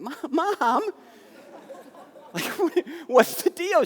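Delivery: high, squeaky, laughing disbelief; a son who can't believe his ears; shocked disbelief. Retold or imitated. Son shocked by mom's answer.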